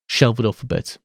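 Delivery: ordinary delivery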